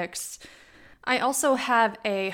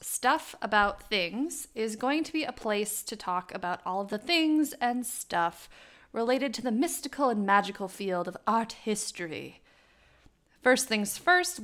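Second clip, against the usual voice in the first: usual speaking voice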